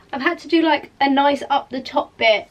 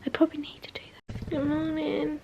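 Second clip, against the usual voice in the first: whispering; 3 semitones higher, 8 dB quieter than usual. Real, not acted. Whispering, someone asleep nearby.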